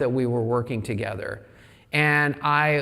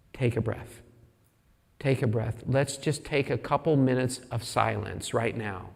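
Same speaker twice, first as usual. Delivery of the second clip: calming; 2 semitones lower, 5 dB quieter than usual. Retold or imitated. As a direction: gently settle someone down, pausing between phrases. Retold friend calms the panicking speaker.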